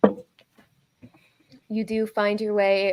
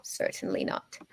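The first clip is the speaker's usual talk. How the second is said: put-on old lady voice; 3 semitones higher than usual. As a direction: gently whisper like a crone. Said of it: "my old lady voice".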